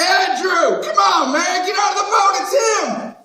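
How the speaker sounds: loud, high yell across the water; calling out. Acted. Peter shouting for Andrew to come ashore. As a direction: hurry him out of the boat.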